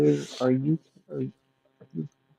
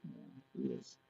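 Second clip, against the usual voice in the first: whispering; 13 semitones lower, 14 dB quieter, faster than usual. Real, not acted. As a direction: keep it a whisper. Baby asleep beside her.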